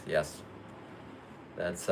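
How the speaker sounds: ordinary talk, nothing marked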